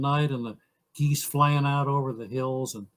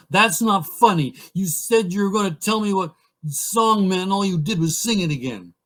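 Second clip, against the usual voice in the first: loud, higher-pitched mock protest; make-believe mad; 4 semitones higher, 5 dB louder than usual. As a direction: act out a child's pretend tantrum. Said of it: "I'd pretend to get mad".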